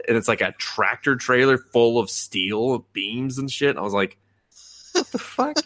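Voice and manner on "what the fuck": high-pitched incredulous outburst, retold. Viewer reacts to absurd mental training, mock incredulous. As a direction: replay your disbelief way up high.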